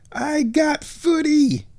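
high, slow, excited shout of joy; thrilled shout. Real, not acted. Fan cheering that he gets footy tonight, thrilled shout.

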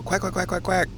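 string of imitation duck quacks; mimicked duck quacks. Shown as a demonstration. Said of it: "a good blast of duck quacks".

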